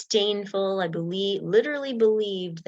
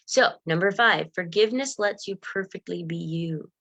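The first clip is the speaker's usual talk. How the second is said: ordinary talk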